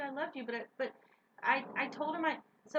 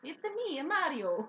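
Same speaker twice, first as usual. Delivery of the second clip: Mario imitation; 2 semitones higher than usual. Friend joking about a fun word, in a Mario voice. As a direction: playfully imitate Mario's sing-song greeting. Quoting the famous Mario catchphrase.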